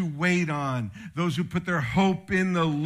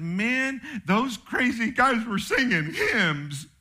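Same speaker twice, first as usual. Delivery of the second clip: amazed jailer; 6 semitones higher, slower than usual. Retold or imitated. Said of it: "the jailer came back to the church and said".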